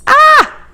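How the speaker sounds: sudden high, loud startled yelp; a real jump-back yelp at popping oil. Real, not acted. Cook startled as hot oil pops, high yelp.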